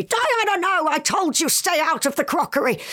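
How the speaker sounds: scolding. Pretend. Scolding the spirit over crockery.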